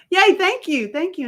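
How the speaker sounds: high, loud put-on delight; bubbly mock thanks that fades; fake cheer. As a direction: gush like you're thrilled. Fake gratitude to a stranger.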